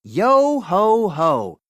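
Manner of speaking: slow, loud yo ho ho; slow chant of the password; pirate password. Shown as a demonstration. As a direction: draw out each yo and ho. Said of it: "just say the pirate password".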